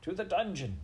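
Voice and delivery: high-pitched accusing cry; a mild shout from the crowd. A narrator's reading. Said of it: "a voice cried out".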